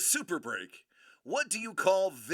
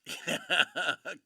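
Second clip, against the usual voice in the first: short burst of chuckling; laugh; 3 dB louder than usual. Real, not acted. Host amused before naming the old chef variant, laughing. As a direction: chuckle out loud.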